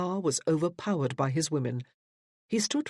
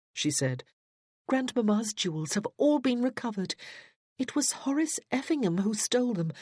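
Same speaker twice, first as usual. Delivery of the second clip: higher, steady earnest voice; earnest young woman; 6 semitones higher than usual. Acted. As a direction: explain herself firmly to Papa. Judith explains to her father.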